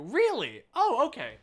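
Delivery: high, loud surprised reaction. Real, not acted. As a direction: react with real surprise to the news. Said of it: "kilts are an Irish thing too".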